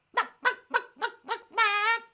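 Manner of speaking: very high, loud "buck, buck" clucks; imitated bird call. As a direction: cluck shrilly like a strange bird. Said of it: "It just went".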